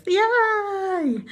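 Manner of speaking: long, high-pitched drawn-out yay; playful squeal of celebration; playful cheer. Pretend. Cheering the line dancing idea.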